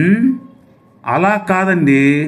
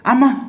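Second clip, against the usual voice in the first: high cry of pain; 11 semitones higher, faster than usual. Acted. Cry after being hit.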